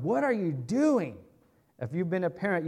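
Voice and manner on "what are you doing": high swoop on DOING, a parent's rebuke; DOING stressed hard, rising then falling in reproach; challenging demand. Shown as a demonstration. Preacher acting a parent confronting a child, challenging rebuke. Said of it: "It's that looking at somebody going".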